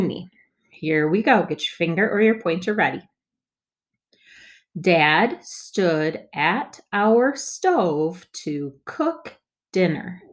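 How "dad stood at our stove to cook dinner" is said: slow, word by word; slow choppy reading. Shown as a demonstration. Teacher models first slow read for kids. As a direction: read each word apart, slowly. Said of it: "let's read it more smoothly".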